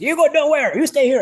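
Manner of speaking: high, loud imitation of an insistent coach; retold insistence. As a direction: belt out the coach's order. Retold coach repeating his order.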